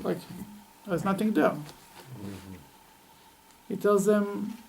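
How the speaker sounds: ordinary talk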